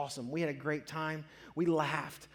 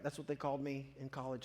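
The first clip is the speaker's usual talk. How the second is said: usual speaking voice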